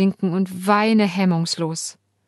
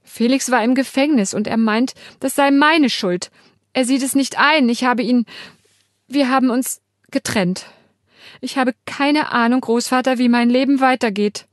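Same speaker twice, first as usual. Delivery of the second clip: tearful, close to sobbing; 5 semitones higher, 4 dB louder than usual. Acted. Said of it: "Jetzt schluchze ich haltlos".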